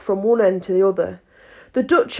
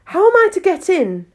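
put-on high girlish voice; 9 semitones higher, 3 dB louder than usual. A narrator's reading. Alice pleading at door.

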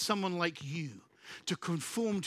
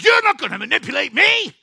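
loud, defiant retort; defiant shout; 9 semitones higher, 15 dB louder than usual. Acted. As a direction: snap back in defiance. Contrasting defiant reply.

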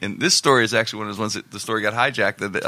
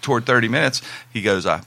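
usual speaking voice

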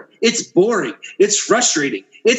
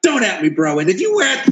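ordinary talk, nothing marked

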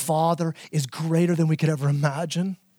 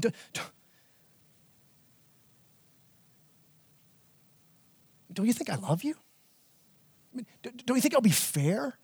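tender plea; 3 semitones higher, 7 dB quieter, faster than usual. Retold or imitated. Dad asking daughter tenderly.

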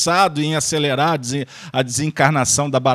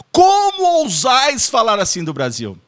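higher, louder theatrical outrage; hammed-up patriotic indignation; mock lofty rebuke; 8 semitones higher, 4 dB louder than usual. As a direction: defend the country like a stage hero. Mock archaic rebuke, callback joke.

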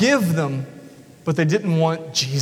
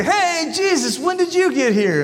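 higher, bright mock greeting; cheery crowd; 9 semitones higher than usual. Preacher voices the crowd hailing Jesus across the lake, higher and cheery. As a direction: call out like a crowd that chased him down. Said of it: "they say".

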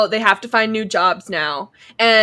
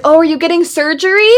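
mock jab; 7 semitones higher, 6 dB louder, faster than usual. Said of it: "And then he's like".

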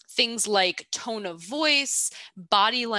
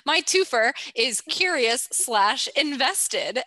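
ordinary delivery

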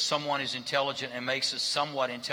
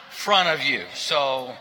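ordinary talk, nothing marked

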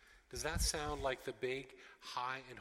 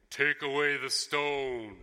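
booming, slow command; authority and power; 14 dB louder, slower than usual. Acted. Imagined almighty Jesus at the tomb, powerful and authoritative. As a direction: thunder it out, untouchable and commanding. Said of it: "Jesus being this voice of authority and power".

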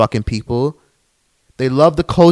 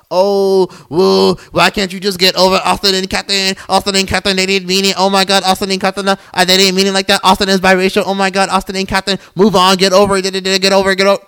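high monotone mimicry of naysayers, rattled off; mocking whiny commenters; 5 semitones higher than usual. Podcaster imitating commenters who say get over it, mocking. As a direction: parrot them in a high, droning, dismissive run.

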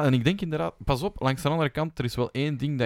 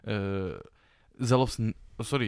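ordinary talk, nothing marked

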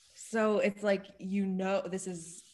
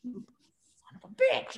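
mock angry curse; 4 semitones higher than usual. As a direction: snap a jokey curse at your reflection. Cursing at himself in mirror.

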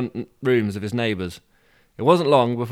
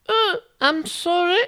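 very high, slow posh falsetto; posh old woman; slower than usual. Shown as a demonstration. Reader trying an old woman's voice, too posh. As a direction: apologise like a posh old lady. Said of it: "No, it sounds very posh".

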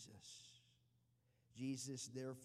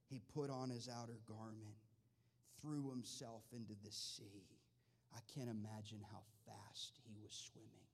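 usual speaking voice

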